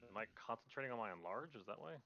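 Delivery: ordinary talk, nothing marked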